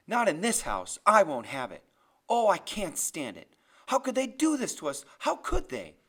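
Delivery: loud outburst climbing high; distraught yelling. A narrator's reading. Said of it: "Graham yells".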